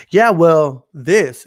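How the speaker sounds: insistent comeback. Retold or imitated. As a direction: jump back in with a mock-stubborn retort. Retold stubborn arguer's comeback.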